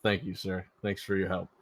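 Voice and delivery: ordinary delivery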